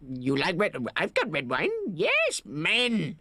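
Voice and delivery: imitated Indian accent, rising high at the end; playful Indian-accent impression; B K's Indian accent. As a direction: mimic an Indian man's eager exclamation. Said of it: "strong Indian accent".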